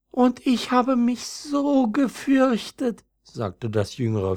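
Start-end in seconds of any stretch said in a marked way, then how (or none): 0.1-3.1 high, slow, frightened little flower; timid young violet. Acted. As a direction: draw out the fear in a much higher voice. Younger violet confesses its fear. (ängstliches junges Veilchen, hoch und langsam)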